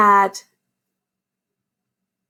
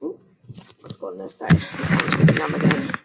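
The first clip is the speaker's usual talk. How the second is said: whispered aside; 6 semitones lower than usual. Real, not acted. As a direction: whisper it as a quick side note. Aside to class while turning pages.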